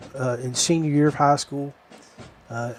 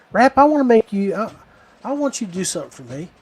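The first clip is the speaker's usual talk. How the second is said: imitated drill sergeant; 5 semitones higher, faster than usual. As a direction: pitch up on the name, then hurry the request. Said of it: "He's like".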